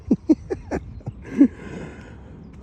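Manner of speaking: long breathless laugh, high; high wheezy laugh. Real, not acted. Vlogger laughing at texting Laura for a lift, high wheezing laugh.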